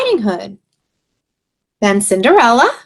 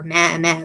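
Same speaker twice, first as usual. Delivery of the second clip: goat bleat; 4 semitones lower, 5 dB quieter than usual. Shown as a demonstration. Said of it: "Bleated means like that".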